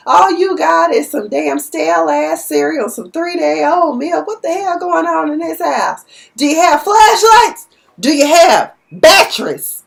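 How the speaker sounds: high, loud, incredulous rant; indignant mock lecture; exasperated loud scolding. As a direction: scold him for not preparing for the storm. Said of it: "he was like".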